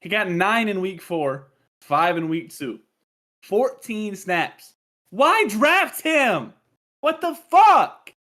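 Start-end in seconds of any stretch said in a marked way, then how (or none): 3.4-8.1 slow, rising into a loud outraged yell. Real, not acted. Fan venting about a wasted draft pick, slow then loud outrage. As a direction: drag it out, then blow up at the pick.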